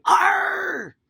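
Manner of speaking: loud, high pirate Arr; pirate shout. Shown as a demonstration. Coach showing the kids the pirate cry, a loud high shout. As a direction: yell it like a cheery pirate. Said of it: "You gotta say".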